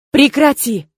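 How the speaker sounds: a loud, exasperated reprimand; scolding shout. A narrator's reading. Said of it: "прикрикнула я на своего благоверного".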